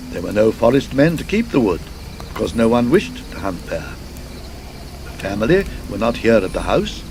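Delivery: ordinary delivery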